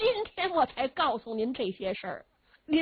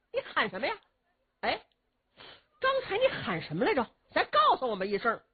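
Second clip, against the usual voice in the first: mocking nonchalance; 5 semitones higher, faster than usual. Chess player mocks the general.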